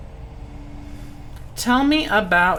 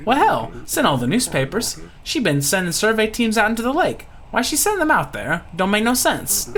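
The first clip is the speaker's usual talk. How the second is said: higher-pitched, folksy Louisiana voice; puzzled Louisiana pirate; 7 semitones higher than usual. Acted. Lucky puzzling over Laura's survey teams, high Louisiana voice. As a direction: sound baffled, like a bayou local.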